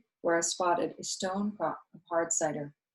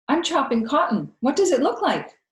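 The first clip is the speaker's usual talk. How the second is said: put-on high, louder child's retort; defiant child voice; 8 semitones higher, 8 dB louder than usual. A narrator's reading. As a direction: snap back like a stubborn girl. Child answering Viola back.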